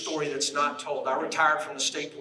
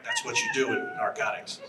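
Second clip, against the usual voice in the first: usual speaking voice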